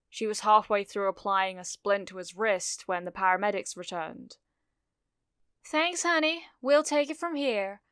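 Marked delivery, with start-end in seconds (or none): 5.6-7.8 much higher-pitched paramedic taking over; paramedic's voice. A narrator's reading. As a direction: pitch it up high for the helper stepping in. Paramedic takes over from the girl.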